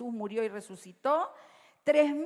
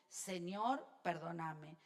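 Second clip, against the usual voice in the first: oración suave; 6 semitones lower, 10 dB quieter, slower than usual. Shown as a demonstration. Oración citada en voz baja.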